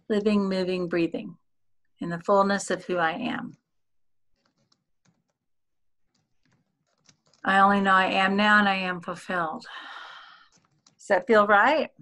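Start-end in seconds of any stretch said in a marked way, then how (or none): 0.1-9.6 guided affirmation. Acted. Leads an affirmation.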